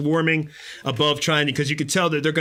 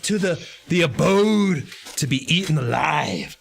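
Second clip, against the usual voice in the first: dramatic announcer; 2 semitones higher than usual. Acted. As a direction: build suspense like a trailer. Dramatic game-master cliffhanger.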